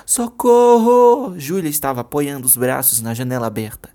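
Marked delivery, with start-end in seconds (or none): 0.0-1.4 long, high, loud scream; desperate scream from the window; screaming for help. Acted. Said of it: "Júlia correu até a janela e gritou".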